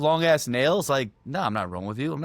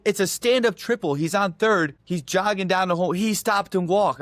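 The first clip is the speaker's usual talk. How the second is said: higher, emphatic re-enacted protest; retold heated argument; 6 semitones higher, 3 dB louder than usual. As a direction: argue the call point by point, worked up. Acted argument with the umpire.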